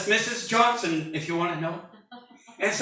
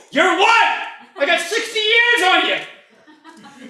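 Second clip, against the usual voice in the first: much higher and louder, slowing on the second line; play-offended at a toddler; mock indignant; 11 semitones higher, 6 dB louder, slower than usual. Mock scolding a one-year-old.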